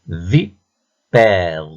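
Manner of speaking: "Vipère" as vi, pause, long père; drawn-out "Vipère" in two beats; split into syllables. Shown as a demonstration. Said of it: "repère le son qui se répète".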